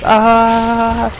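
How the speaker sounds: high drawn-out mock scream; marine scream. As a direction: let out a long high cartoon yell. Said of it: "He's just screaming constantly".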